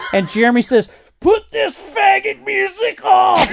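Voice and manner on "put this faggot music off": high, stretched-out angry shout; rowdy metalhead yell; yelled heckle. Retold or imitated. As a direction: heckle the DJ from the crowd. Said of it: "yelled out a couple of inappropriate things".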